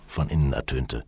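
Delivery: plain reading voice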